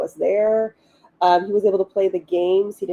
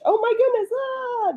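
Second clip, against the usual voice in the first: high squealing burst of joy; excited squeal. Real, not acted. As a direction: squeal it out, thrilled. Sudden excitement about release.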